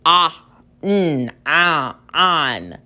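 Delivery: "ah, mm, ah, on" sound by sound; "on" built slowly from separate sounds; tapping out sounds. Shown as a demonstration. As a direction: sound out each piece, then blend to "on". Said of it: "if I were to tap it out".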